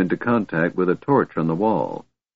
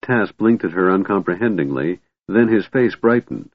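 usual speaking voice

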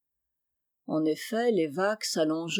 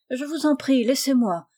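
colère feinte; 5 semitones higher than usual. Acted. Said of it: "en affectant un air courroucé".

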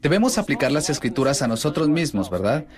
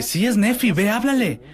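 excited urging; 8 semitones higher than usual. Acted. Girl urging friend toward Nefi.

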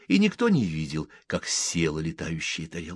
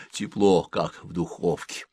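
usual speaking voice

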